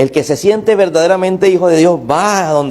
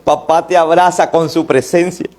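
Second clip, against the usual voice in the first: ordinary talk, nothing marked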